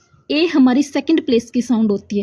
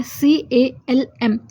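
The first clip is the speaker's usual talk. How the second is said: slow letter by letter spelling; much slower than usual. Shown as a demonstration. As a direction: spell each letter separately and slowly. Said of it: "spelling में तो क्या दे रखा है". (अक्षर-अक्षर धीरे बोलना)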